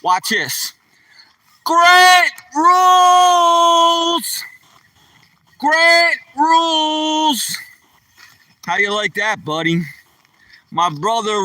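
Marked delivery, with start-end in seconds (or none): 1.6-4.4 long drawn-out yell, very high and loud. Real, not acted. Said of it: "You want me to yell your name out real loud". 5.6-7.6 drawn-out yell, high and loud. Real, not acted. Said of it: "You want me to yell your name out real loud".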